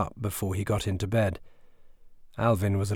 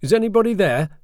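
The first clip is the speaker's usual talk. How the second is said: loud, high call into the dark; dad calls down into the dark house; 11 semitones higher, 8 dB louder than usual. Acted. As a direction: call it out sharply. Dad calling down stairs.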